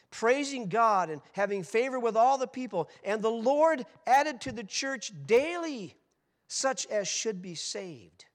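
loud, high, slow reading; preacher reading Acts aloud to the crowd; preached scripture. Acted. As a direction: proclaim the verse with fire.

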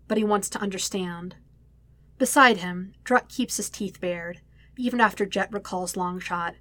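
usual speaking voice

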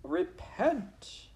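very high-pitched, frantic motherly plea; mother shouting. A narrator's reading. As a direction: implore your son in a piercing falsetto. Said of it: "shouted his mother".

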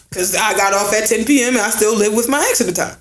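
ordinary delivery

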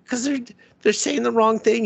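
high-pitched indignant imitation; mock indignant defence. Uncle defending his comment corrections, mock indignant. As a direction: protest in a put-on high voice.

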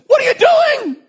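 very loud, high outraged shout; indignant donkey. Acted. Donkey turning on the master who hits it, indignant. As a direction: shout it in shrieked protest. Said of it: "the donkey turns around at him and says".

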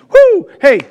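loud, high whoop of fake delight; mock celebration. Preacher mimicking glee when sickness comes, mock excited whoop. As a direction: cheer it like great news, hammed-up.

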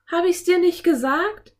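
high, loud triumphant call; calling out, panting. A narrator's reading. Anne to her brother after the chase, high loud call. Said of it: "rief Anne keuchend".

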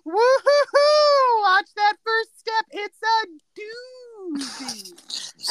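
high, loud, slow taunting sing-song, drawn-out hoots; gleeful Ned. Retold or imitated. Ned gloats at Phil stepping into the puddle, high, loud and slow. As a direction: hoot it out and gloat slowly over the soaked leg. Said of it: "Ned's response is to go".

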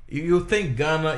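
ordinary talk, nothing marked

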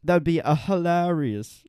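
slow, put-on British accent. Taking up co-host's idea, British accent. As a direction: draw it out in mock British. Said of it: "Let's talk in British accents".

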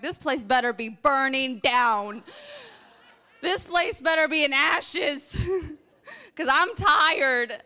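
slow, loud exasperated grumble; tired mock outrage. Exhausted woman at a hotel fire alarm, slow and grumpy. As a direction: grumble that it better be worth it. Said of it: "And I'm like".

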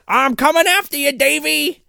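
high, taunting stalker call; menacing taunt. Retold or imitated. Podcaster voices bully chasing teacher, taunting and high. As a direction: sing out the threat like a creepy stalker. Villain threat acted.

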